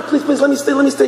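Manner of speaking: high, hurried mock begging; acted frantic plea; pleading. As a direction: beg not to be thrown out. Acted student begging to stay.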